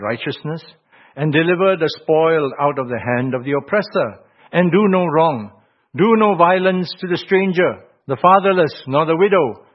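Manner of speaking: emphatic, proclaimed Bible verses; scripture reading. Real, not acted. Preacher reads God's commands to the congregation, forceful scripture reading.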